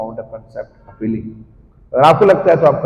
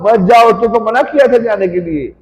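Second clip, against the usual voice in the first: alarmed warning; 10 semitones higher, 5 dB louder than usual. Acted. Cowherd friends forbidding entry to the forest, alarmed. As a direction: cry out to stop them going. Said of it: "कुछ लोग सखा लोग मना करते हैं".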